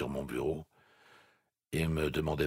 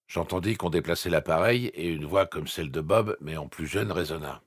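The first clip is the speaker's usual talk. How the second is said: usual speaking voice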